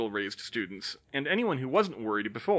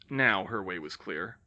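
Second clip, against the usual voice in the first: ordinary delivery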